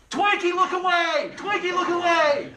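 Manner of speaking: high, loud, slow drawn-out yell; coaches yelling. Retold or imitated. Coaches yelling at their wrestler mid-match, loud slow shout. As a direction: bellow instructions from the corner. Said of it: "hear your coaches going".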